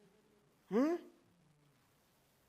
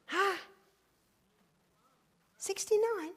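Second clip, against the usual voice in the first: high loud huh of mock disbelief; mock surprise; 9 semitones higher, 5 dB louder than usual. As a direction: act stunned by the number. Feigned shock at bread's number.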